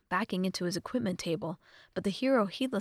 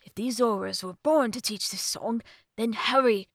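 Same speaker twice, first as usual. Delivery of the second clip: put-on loud, emphatic old scholar; urgent professor; 2 semitones higher, 7 dB louder than usual. Old professor urges Link to play the song, urgent. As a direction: press him with loud urgency.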